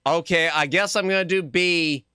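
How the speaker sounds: ordinary talk, nothing marked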